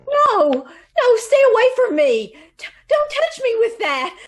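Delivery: high, loud panicked plea; terrified screaming. Acted. Secretary begging the woman with the hypodermic, terrified. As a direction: scream in terror at the needle. Victim recoils from the needle.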